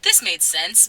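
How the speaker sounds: ordinary talk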